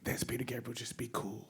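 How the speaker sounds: quiet, hushed pep talk to himself; starstruck, murmuring to himself to keep it together; hushed self-talk. Retold or imitated. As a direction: tell yourself under your breath to stay calm. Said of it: "I was like".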